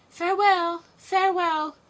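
high, loud farewell cry; calling farewell. Acted. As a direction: call out after the carriage. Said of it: "cried the prince and princess".

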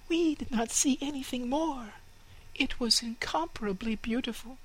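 very high chirpy little sparrow voices; excited twittering sparrows; chirping sparrows. Acted. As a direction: chirp it like eager little birds. Sparrows answer.

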